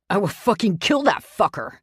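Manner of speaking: a loud furious scream. Acted. Boyfriend screams into the phone at the attacker, furious. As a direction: hurl the threat at full volume. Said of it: "he screams into the phone".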